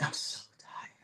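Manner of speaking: whispered, worn out; weary whisper to herself; tired whisper. Retold or imitated. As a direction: breathe it out like you're exhausted. Retold thought of exhaustion.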